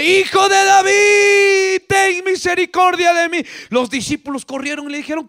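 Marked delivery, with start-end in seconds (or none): 0.0-3.4 high, drawn-out cry for mercy; shouted plea. Acted. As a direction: call out slowly like the blind beggar. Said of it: "El ciego Bartimeo gritaba".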